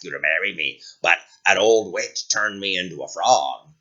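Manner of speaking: low, croaky frog prince. Acted.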